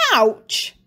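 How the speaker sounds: sudden yelped ouch. Acted. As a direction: yelp in pain. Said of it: "he yelped".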